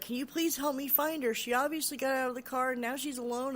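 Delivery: mildly frantic retold appeal; urgent pleading. Woman pleading for help finding girl.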